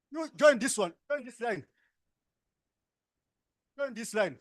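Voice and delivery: loud, high directing call. Real, not acted. Facilitator ordering participants into a line, loud. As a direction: call them into line over the noise.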